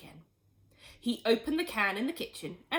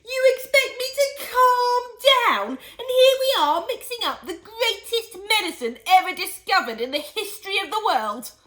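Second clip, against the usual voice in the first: excited outburst; 13 semitones higher, 12 dB louder than usual. Acted.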